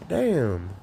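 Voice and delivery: ordinary talk, nothing marked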